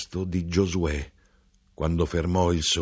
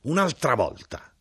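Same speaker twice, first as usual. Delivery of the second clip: yelled; 8 semitones higher than usual. A narrator's reading. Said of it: "E l'arrotino urlò".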